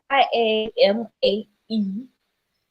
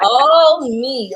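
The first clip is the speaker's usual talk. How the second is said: excited echo; 4 semitones higher, 8 dB louder than usual. Retold or imitated. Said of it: "I was like".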